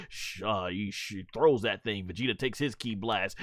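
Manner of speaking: ordinary delivery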